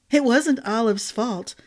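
usual speaking voice

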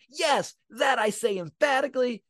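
high, forceful accusation; emphatic. Acted. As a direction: hammer out YES and EMPHATICALLY with conviction. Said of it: "that's not just a nod or a shrug".

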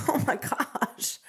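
whispered, slowed oh my gosh; hushed aside. Pretend. Savoring the attention from haters, whispered. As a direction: breathe it out like a secret thrill. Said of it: "be like".

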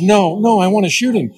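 high, insistent pleading; a hint of a stubborn daughter. Daughter insisting to Dad she wants to shoot, high and insistent. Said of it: "She goes".

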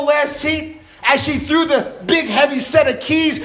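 ordinary talk

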